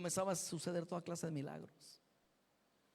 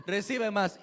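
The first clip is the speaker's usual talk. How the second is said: louder "Recibe más", pushed with a laugh; loud preacher's call; 9 dB louder, slower than usual. Retold or imitated. Copying the preacher to the crowd, loud call. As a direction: urge the crowd to receive more. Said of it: "porque así decía él".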